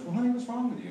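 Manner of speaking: put-on high sugary "honey" from a working girl; mock sweet working girl. Working girl teasing a nervous young customer, mock sweet. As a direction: coo it like a teasing madam's girl. Prostitute voiced in joke.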